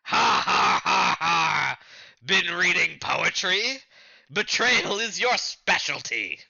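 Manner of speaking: booming villain with a forced laugh; Arlong. Acted. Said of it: "you play Arlong".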